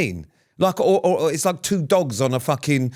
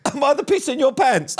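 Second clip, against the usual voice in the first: high, hammed-up gushing question; mock delighted guest; 11 semitones higher than usual. Visitor reading a wall sign aloud, mock delighted. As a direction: squeal it like an absurdly tickled visitor. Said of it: "come to your house and go".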